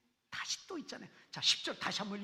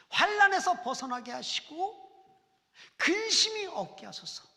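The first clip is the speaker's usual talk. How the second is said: loud, slow, high fervent plea; fervent prayer; 7 semitones higher, 13 dB louder than usual. Acted. Preacher voicing Jabez crying to God, fervent and loud. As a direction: cry out the prayer slowly and loudly.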